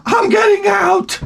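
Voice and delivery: high, drawn-out shout; hammed-up, slow high holler; mock wife's yell. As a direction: imitate an exasperated wife yelling on one high pitch. Imitated wife announcing she's leaving.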